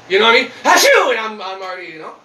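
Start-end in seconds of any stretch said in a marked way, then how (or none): 0.6-1.1 loud, high-pitched "achoo"; mock sneeze. Shown as a demonstration. Driver shows listeners a sneeze behind the wheel, mock sneeze. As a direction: burst out a pretend sneeze. Mimics sneezing while driving.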